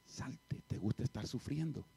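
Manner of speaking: very soft, half-whispered command; suavecito. Retold or imitated. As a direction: order it out under your breath. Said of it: "pero yo suavecito". (orden dicha bajito, casi susurrada)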